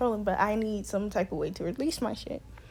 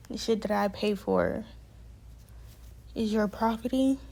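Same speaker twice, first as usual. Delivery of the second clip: restrained disbelief; 4 dB quieter than usual. Retold or imitated. Resident repeats staff's claim on their belongings, restrained disbelief. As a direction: repeat the claim evenly, holding the outrage in.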